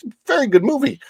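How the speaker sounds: high, wide-eyed retold amazement; amazed wow. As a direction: squeal it out like you just got blown away. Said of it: "I'm like".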